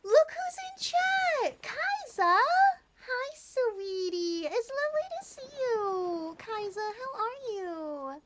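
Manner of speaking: high, drawn-out sing-song welcome; gushing high-pitched hello; delighted greeting. Real, not acted. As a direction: greet a newcomer loudly and high, stretching the vowels. Greeting a viewer arriving in chat.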